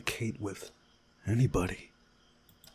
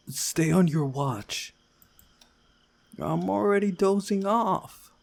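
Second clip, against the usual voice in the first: higher, drawn-out sleepy lines; sleepy guard swap; 8 semitones higher, 3 dB louder than usual. Acted. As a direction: voice the tired Router.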